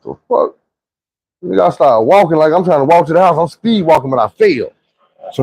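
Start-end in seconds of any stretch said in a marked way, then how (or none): 0.0-0.6 confused. Retold or imitated. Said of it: "I'm looking at them like".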